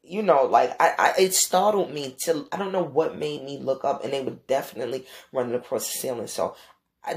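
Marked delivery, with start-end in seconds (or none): none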